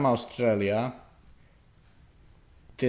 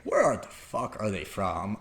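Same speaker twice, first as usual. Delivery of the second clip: much softer, low puzzled thought; puzzled inner aside; 4 semitones lower, 7 dB quieter than usual. Retold or imitated. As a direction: mutter it to yourself, baffled. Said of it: "And I was like".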